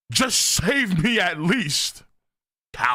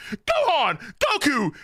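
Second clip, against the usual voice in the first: high, shouted angry-wife call; hammed-up scolding Chi-Chi shout; mock Chi-Chi yell; 10 semitones higher, slower than usual. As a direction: bark him in for lunch like a furious wife. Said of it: "Chi-Chi powers up".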